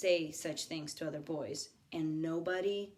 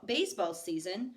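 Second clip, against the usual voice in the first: ordinary delivery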